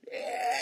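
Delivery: very high, airy cheer; excited squeal. Acted. Preacher imagining thrilled parents of a boy, high squeal.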